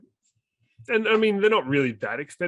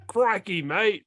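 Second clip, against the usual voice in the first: hammed-up Australian accent, pitched higher; a cartoon Australian, higher up; mock Australian accent; 7 semitones higher, slower than usual. Joking cliche Australian accent for the podcast cohost. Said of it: "I'm not good with accents".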